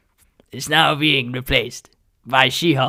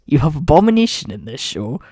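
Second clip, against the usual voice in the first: ordinary talk, nothing marked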